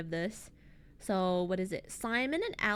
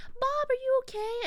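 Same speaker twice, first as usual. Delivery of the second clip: very high, urgent check; worried rescuer; 3 dB louder than usual. Retold or imitated. Matthew calls to Bob after the bandits, very high and anxious. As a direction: call out anxiously to your friend. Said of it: "he's like".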